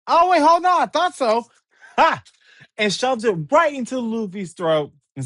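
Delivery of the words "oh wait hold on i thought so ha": much higher, louder sudden cry of realization; caught-you shout. Acted. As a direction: shout it as you catch the trick. Zoro catches the booger prank.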